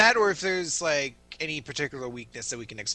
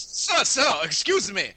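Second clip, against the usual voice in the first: high, raised indignant character voice; indignant interruption; 5 semitones higher, 5 dB louder, slower than usual. Acted. Character objecting to another's ridiculous voice, indignant. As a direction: cut in loudly, affronted. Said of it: "What absolutely ridiculous voice are you doing".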